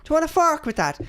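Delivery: high, mock Irish accent; hammed-up stage-Irish lilt; Merlin. Mocking the film wizard offering a sword, terrible Irish accent. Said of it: "It's, it's Merlin".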